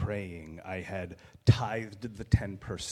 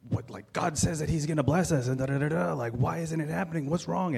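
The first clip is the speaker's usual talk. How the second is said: raised, pleading voice, rising in frustration; exasperated complaint; 6 semitones higher, 4 dB louder than usual. Retold or imitated. Venting to his wife about unanswered blessings, exasperated and higher. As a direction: vent to your wife, baffled and pitched higher. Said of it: "I was saying".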